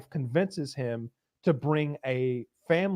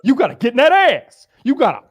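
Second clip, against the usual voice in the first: loud, higher, laughing pep talk; hyped-up tough guy; 5 semitones higher, 8 dB louder than usual. Retold or imitated. Leon urging Larry to get in that ass, loud and pumped. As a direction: fire him up to fight back. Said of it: "Leon's like".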